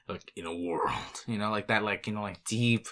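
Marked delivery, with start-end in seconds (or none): 0.4-1.2 hushed half-whispered trailer voice imitation; a light whispered hint of the trailer announcer; movie trailer voice. Shown as a demonstration. Friend demos the movie trailer voice, whispered. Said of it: "that trailer voice".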